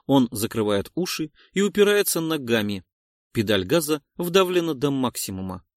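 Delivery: plain reading voice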